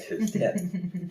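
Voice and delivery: high, slow singsong; drawn-out teasing singsong; scurrying narration. Acted. Storyteller to listener as the bear flees, high slow singsong.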